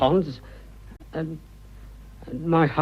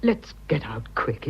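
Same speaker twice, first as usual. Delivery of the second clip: slow, softer, voiceless whisper; urgent whisper; 6 dB quieter, slower than usual. Acted. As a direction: hush it like a secret plan. Urging escape with lover.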